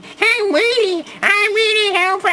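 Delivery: very high, slow squeaky mimicry; mock a little robot in a piercing squeal; Wheelie. Mocks Wheelie in his voice.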